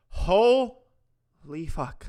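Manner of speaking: slow, loud astonished swear; surprised. Real, not acted. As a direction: drag it out in shock. Drawn-out astonishment.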